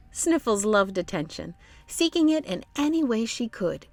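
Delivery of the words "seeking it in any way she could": usual speaking voice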